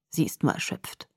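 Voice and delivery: soft, low, discreet aside; muted. A narrator's reading. Doctor reassuring the father, muted. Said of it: "gedämpft zu Charlie".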